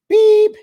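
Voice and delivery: loud high beep sound; beep noise. Pretend. Vlogger bleeping the curse, a loud high beep. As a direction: make the censor bleep yourself.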